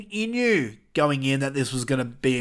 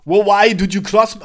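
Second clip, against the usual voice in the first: loud, higher attempt at an Irish accent; badly faked Irish accent, raised and forceful; 5 semitones higher, 7 dB louder than usual. Finn confronting Damian in the ring, botched Irish accent. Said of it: "I can't do an Irish accent".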